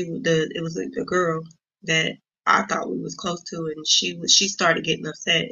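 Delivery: ordinary delivery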